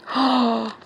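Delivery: audible gasp of delight. Real, not acted. Vlogger opening a friend's gift, delighted gasp. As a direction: gasp at a lovely surprise.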